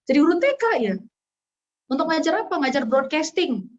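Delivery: a touch of amazed disbelief; surprised friend asking again; surprised question. Retold or imitated. Retelling friend's surprising request.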